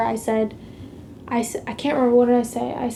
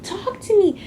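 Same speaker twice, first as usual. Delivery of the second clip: retold yelling; 9 semitones higher than usual. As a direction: mimic the girl getting in her face, pitched up high. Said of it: "Literally yelling at me".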